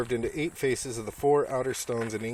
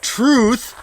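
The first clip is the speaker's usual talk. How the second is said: loud reading; 10 semitones higher, 12 dB louder than usual. Real, not acted. Reading carved word excitedly.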